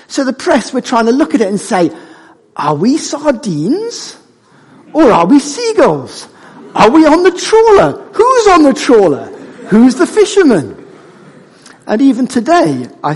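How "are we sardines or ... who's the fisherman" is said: high-pitched puzzled questions, building louder; hammed-up string of bewildered questions, pitched well up; mock bafflement. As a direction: act out the press frantically trying to solve a riddle. Voicing the baffled press's questions.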